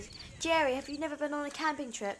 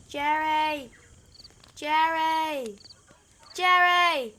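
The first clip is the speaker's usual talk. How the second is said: louder and louder shouts of Jerry; calling out; 4 semitones higher, 8 dB louder, much slower than usual. Real, not acted. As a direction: yell for him across the dark. Calling out for lost friend.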